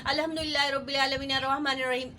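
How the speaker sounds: fast, hurried recital run together; rushed recitation. Shown as a demonstration. Teacher demonstrating the wrong hurried recitation to students. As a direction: gabble it to show the wrong way. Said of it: "आपने ऐसे नहीं पढ़ना है".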